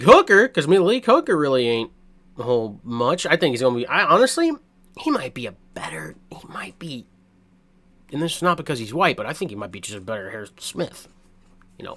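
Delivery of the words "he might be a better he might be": whispered aside. Real, not acted. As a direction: drop to a secret whisper. Hushed aside about comparison.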